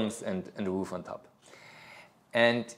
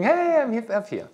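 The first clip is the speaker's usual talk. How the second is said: put-on much higher, excited child's shout-out from above; excited kid calling; 12 semitones higher, 4 dB louder than usual. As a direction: call down proudly like a boy on the roof. Son calls from the roof.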